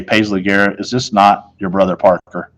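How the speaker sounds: plain reading voice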